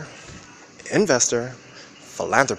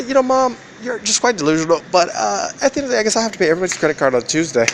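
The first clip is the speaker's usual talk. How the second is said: a hint of a mocking squeaky voice, much higher and louder; sarcastic falsetto aside to mom; 10 semitones higher, 5 dB louder than usual. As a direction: lightly mimic yourself, pitched up.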